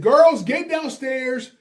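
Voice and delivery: high, loud, slow yell upstairs. Retold or imitated. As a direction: call the girls down, loud and drawn out. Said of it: "I yell".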